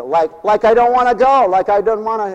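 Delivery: light Valley Girl imitation, stretching the like; lazy Valley Girl whine; a hint of Valley Girl talk. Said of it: "I like that word they use".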